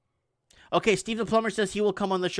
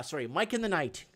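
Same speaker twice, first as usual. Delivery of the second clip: ordinary talk, nothing marked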